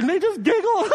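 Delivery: laughing while telling it; giggling. Real, not acted. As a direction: giggle through the words.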